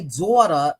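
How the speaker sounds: "daughter" as "daw-tuh", New York style; New York accent. Real, not acted. Puzzled at how they pronounce a chat name, in a New York accent. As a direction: drop the r on "daughter" like a New Yorker. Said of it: "like I'm from New York".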